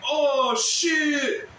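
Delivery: high, loud "Oh shit"; mock shocked yell. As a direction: fling the crowd's cry back. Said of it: "I get from my new community".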